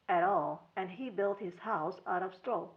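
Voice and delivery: ordinary delivery, nothing marked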